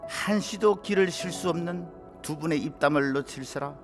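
ordinary delivery

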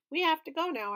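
brisk and urgent. A narrator's reading. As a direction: rush the little ones out the door. Peter hurrying the kids.

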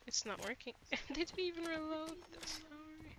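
ordinary delivery

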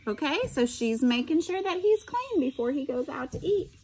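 ordinary delivery

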